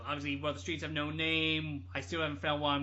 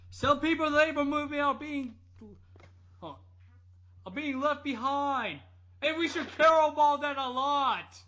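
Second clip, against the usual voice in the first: higher, louder, earnest mock sermon; 9 semitones higher, 9 dB louder than usual. Mocking the singer's stage speech, hammed-up sanctimony. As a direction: ham up a preachy rock star. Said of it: "he just said".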